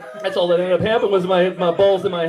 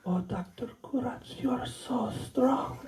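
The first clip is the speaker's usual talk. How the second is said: put-on breathy whispered Russian-accented coo; Russian stripper; 4 semitones higher, 12 dB quieter, slower than usual. Stripper flattering her chiropractor, whispered Russian flirtation. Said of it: "I think, Russian stripper".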